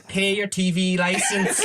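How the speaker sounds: a laughing, slow put-on Armagh accent, like a wise old local; bad Armagh accent. An older Armagh woman advising the listener to pay, in a laughing bad Armagh accent. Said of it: "this is a bad Armagh accent".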